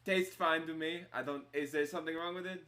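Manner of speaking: put-out tavern keeper in character; defensive innkeeper. Acted. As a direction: defend your wine to a guest. Game master as offended innkeeper.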